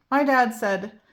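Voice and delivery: ordinary delivery, nothing marked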